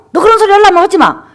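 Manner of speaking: 높고 큰 소리로 꾸짖듯이; 딸을 쫓아내는 엄마처럼 호통. Retold or imitated. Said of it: "엄마가 화를 팍 내면서". (딸에게 화내며 쫓아내는 엄마, 흉내 낸 호통)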